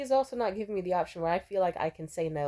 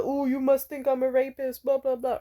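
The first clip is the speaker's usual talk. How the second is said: higher, louder sarcastic mimicry; mocking him; 5 semitones higher, 4 dB louder than usual. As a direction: parrot his excuse with scorn. Mocking his defensive line.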